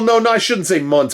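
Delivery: ordinary delivery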